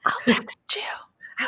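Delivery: slow voiceless whisper. Real, not acted. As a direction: whisper it slowly. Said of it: "so I have to whisper".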